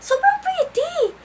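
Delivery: very high, slow, gushing "Sobrang pretty"; squealed self-praise. Shown as a demonstration. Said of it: "Tingnan mo". (matinis at mabagal na "Sobrang pretty")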